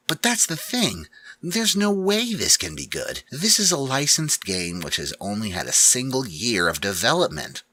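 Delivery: high, strained voice of a doubting gamer; skeptical James. Acted. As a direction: argue it up high, unconvinced.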